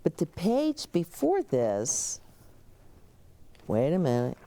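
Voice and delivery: ordinary delivery, nothing marked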